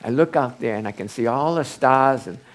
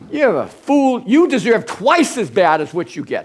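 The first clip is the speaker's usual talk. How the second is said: harsh rebuke; 8 semitones higher, 5 dB louder than usual. Acted. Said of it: "his friend Zophar says".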